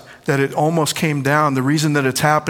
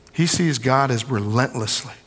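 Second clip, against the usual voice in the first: usual speaking voice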